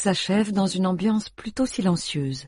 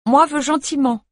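put-on toddler demand; 10 semitones higher, 5 dB louder than usual. A narrator's reading.

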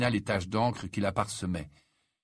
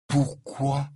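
higher, disbelieving question; incredulous; 5 semitones higher, 3 dB louder than usual. A narrator's reading. Ron questioning Harry saving the potion, incredulous. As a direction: ask in mild disbelief. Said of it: "incrédule".

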